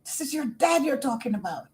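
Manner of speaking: high and fast, lightly incredulous; mock disbelief; shocked inner voice. Her inner voice shocked at what she asked her father. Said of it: "it's like".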